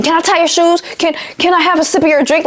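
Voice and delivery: mock-helpful, fed-up customer service; exasperated sarcasm. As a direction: throw out absurd questions in exasperation. Mocking customers who mishear her greeting.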